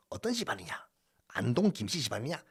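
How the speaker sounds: hushed whispered question. Shown as a demonstration. Teacher whispers a question to the class.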